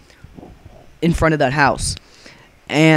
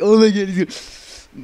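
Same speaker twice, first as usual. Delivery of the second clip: laughing friends; 5 semitones higher than usual. Retold or imitated. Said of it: "Jensen and Matt are laughing".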